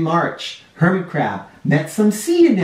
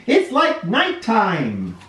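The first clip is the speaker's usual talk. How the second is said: rising higher and higher, slowing; growing dread as it gets murkier; fearful, climbing pitch into slow frightened words; scared in the dark; 10 semitones higher than usual. A narrator's reading. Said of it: "It's so dark in here".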